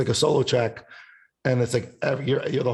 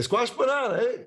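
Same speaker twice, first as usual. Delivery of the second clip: playful retort; 12 semitones higher than usual. Retold or imitated. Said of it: "And I say".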